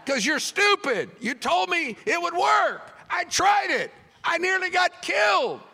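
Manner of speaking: mock-furious tirade; angry shout. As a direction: shout at the man who gave bad advice.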